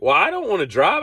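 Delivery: high, whiny refusal. Retold or imitated. Refusing to drive in snow, high whiny. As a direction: whine that you won't drive. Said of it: "And I'm like".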